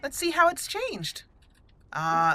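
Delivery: ordinary talk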